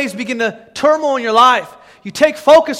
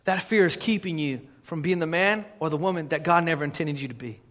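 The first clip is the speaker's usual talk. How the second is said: low, soft preaching; hushed, sincere warning; quiet earnestness; 5 semitones lower, 10 dB quieter than usual. Real, not acted. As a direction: bring it right down, grave and intimate. Quiet close after the shouting.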